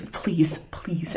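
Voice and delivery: soft breathless pleading; whispered plea. Acted. Mother begging quietly before disappearing, whispered plea. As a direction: beg under her breath as she leaves. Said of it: "Atticus is like".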